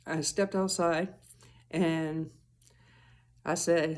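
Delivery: normal talking voice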